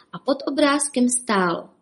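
plain reading voice

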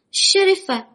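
very high, loud, tiny rabbit cry; thin little voices. A narrator's reading. Rabbits calling the sheriff in alarm, thin high voices. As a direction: cry out in a small squeaky voice. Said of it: "zvolali tenkými hlásky králíci".

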